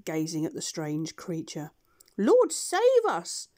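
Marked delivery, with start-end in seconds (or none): 2.2-3.5 very high, loud, slow duck cry; startled duck. Acted. Duck sees the two-headed beast, high startled cry. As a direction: gasp it out like a shocked old duck. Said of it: "said the duck".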